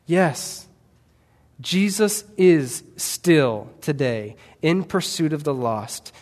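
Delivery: normal talking voice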